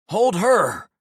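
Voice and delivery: loud, urgent croaking order; croaked shout. A narrator's reading. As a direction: bark the order in panic. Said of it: "I croaked at Carmen".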